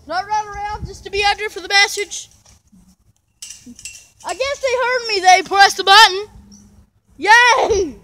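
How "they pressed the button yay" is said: loud happy cheer; excited cheer. Real, not acted. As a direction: celebrate loudly. Siren finally sounds.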